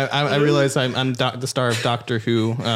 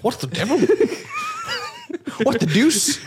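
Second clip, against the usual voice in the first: high-pitched, giggling English exclamation; mock British astonishment. Waking up in Benedict Cumberbatch's body, mock British shock. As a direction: play a startled English gent, breaking into laughter.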